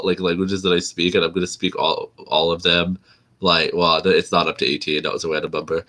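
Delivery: ordinary talk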